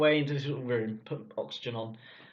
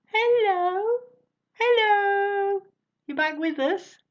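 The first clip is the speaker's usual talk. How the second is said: much higher, loud sing-song hello; nurses calling out; 8 dB louder, much slower than usual. Retold or imitated. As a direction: call him back like a nurse at the bedside. Nurses calling him back round.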